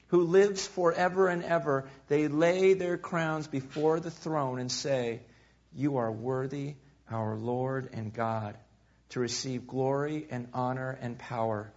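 loud, slow, solemn Bible reading; scripture reading. Acted. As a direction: proclaim the verses slowly and loudly. Reading scripture aloud to congregation.

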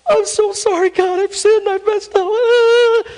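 very high, slow apology; mock repentant Eve. Imagined Eve apologizing to God, very high and slow. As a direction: plead sweetly like a sorry woman.

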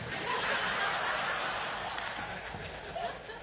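breathy laugh at his own joke; hearty laugh. Real, not acted. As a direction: laugh out at the punchline.

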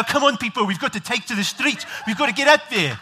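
rally the crowd in a mock South African accent. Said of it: "that's when you bring out the South African".

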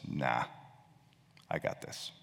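dismissive, half-whispered brush-off; defiant sneer. Retold or imitated. Drowning man to his rescuer, defiant sneer. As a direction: sneer it away, quiet and proud. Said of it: "with kind of a defiant sneer and says".

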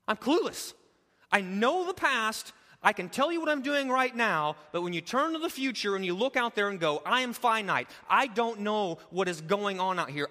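fired-up pastor in full swing; full-force preaching. Acted. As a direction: preach it out to the back row.

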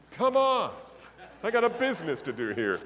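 high, loud exasperated brush-off. Retold or imitated. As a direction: wave him off, fed up. Exasperated owner brushing him off.

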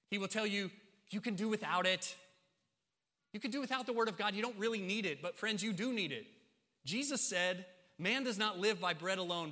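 high, loud sermon delivery; forceful preaching. Real, not acted. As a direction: drive it home to the congregation.

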